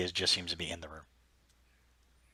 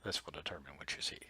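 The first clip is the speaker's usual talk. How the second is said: whispering. Acted. GM whispering narration.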